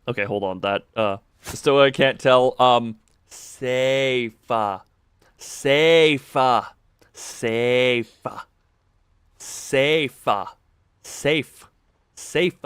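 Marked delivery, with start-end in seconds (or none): 2.6-12.5 safe said slowly and repeated one by one; stress on safe. Shown as a demonstration. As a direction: hit safe clearly each time. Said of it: "I'm emphasizing the word safe".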